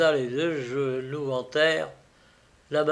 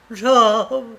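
higher, louder joyful cry; a sudden glad shout of his name; 7 semitones higher, 8 dB louder than usual. A narrator's reading. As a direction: call out in relief at her rescuer. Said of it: "avec un cri de joie".

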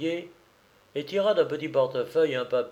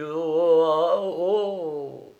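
long, raised "oh, oh" wail; dazed moaning; 4 semitones higher, 4 dB louder, much slower than usual. Acted. Dumolay sinking onto a seat, moaning "oh, oh". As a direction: moan as the legs give way. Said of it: "faisait-il".

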